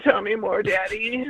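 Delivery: high-pitched, flirty fan voice; swooning female listener. Pretend. Teasing co-host about lady listeners, mock-flirty high voice. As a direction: coo it like a smitten listener. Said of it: "Ladies would listen".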